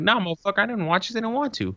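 answering a nosy coworker, mock defiance; mock defiant. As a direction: squeak out a cocky refusal. Retold defiant reply.

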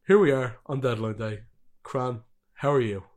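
ordinary delivery

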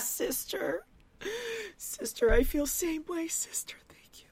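high, breathy mock-tearful whisper, fading away; mock sobbing whisper. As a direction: imitate her weeping through whispered words. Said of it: "Danielle starts sobbing".

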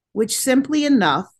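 ordinary delivery

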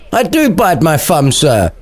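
high-pitched acted retort. Samson answering Abraham's challenge, mock retort. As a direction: answer back with mild defiance. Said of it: "retorted Samson".

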